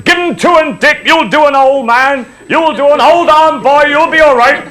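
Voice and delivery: high, loud cheering-on yell; crowd shouting. Retold or imitated. Crowd cheering the narrator on against the bear, shouting. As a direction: egg him on from the crowd. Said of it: "they were shouting".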